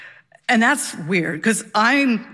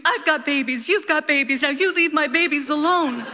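pitched-up mock scream at the bear; retold scream; 6 semitones higher than usual. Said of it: "she punches it and screams".

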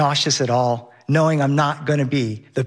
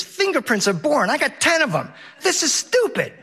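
put-on whiny kid; 10 semitones higher than usual. Son complaining again, rising.

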